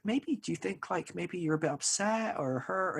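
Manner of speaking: high, soft, tentative suggestion; gently coax, as a concerned partner; tender, careful probing; imitating caring wife. Wife gently suggesting feelings, retold.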